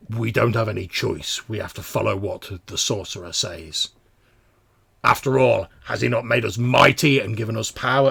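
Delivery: loud, insistent mutant argument; emphatic brute justifying himself; forceful larger mutant. Acted. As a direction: argue it forcefully at your fellow mutant. Voices the larger mutant arguing.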